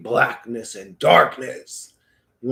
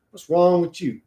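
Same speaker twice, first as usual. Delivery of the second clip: ordinary talk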